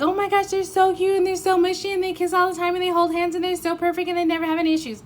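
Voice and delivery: high, fast, cutesy gush; put-on sugary squeal about a perfect couple; mock gushing. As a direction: parody a starry-eyed fan in one breathless rush. Mocking gushing over a perfect couple.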